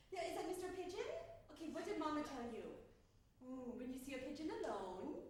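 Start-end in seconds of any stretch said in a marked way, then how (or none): none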